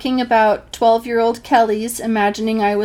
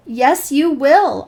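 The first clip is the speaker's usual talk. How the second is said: slow, loud, higher insistence; insistent; 6 semitones higher, 7 dB louder, much slower than usual. Retold or imitated. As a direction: dig in and insist. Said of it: "I insist".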